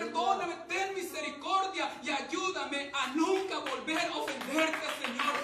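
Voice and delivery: prayer that starts normal and swells into a loud plea; preacher's prayer rising from earnest to loud pleading; pleading prayer building up. Acted. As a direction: beg the Lord for forgiveness, climbing to loud. Voices a repentant prayer.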